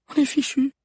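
very high, anxious character voice; high-pitched dismay; despairing murmur. A narrator's reading. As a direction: say it as a hopeless realisation. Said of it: "murmura Agnès".

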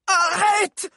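high, loud scream. Acted. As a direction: scream to stop the blow. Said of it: "hurla Valère". (cri aigu et fort)

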